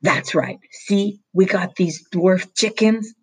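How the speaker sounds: the nervous foreman bluffing about dwarf chickens; lightly voiced nervous foreman. A narrator's reading. Foreman's voice in story.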